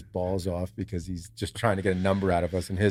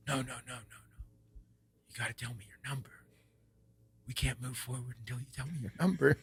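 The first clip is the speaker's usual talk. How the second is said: hushed, breathy whisper, much softer; 12 dB quieter, faster than usual. Retold or imitated. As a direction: whisper it like a secret on a mountainside. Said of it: "we're whispering all this".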